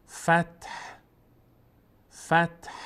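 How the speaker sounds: "فتح" ending in a thin breath of h; thin trace of h. Shown as a demonstration. Teacher modelling the pause, vowelless t and breathed h. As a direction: stop on "فتح", letting only a slip of h escape.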